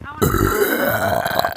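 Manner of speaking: low, loud, slow burped words; burping the words. Real, not acted. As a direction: burp the line out. Repeat of line 8 burped.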